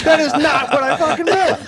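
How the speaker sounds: laughing loudly through the line. Real, not acted. Comic telling the friends the story, laughing hard. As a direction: crack up while protesting.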